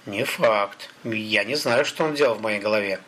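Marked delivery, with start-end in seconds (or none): none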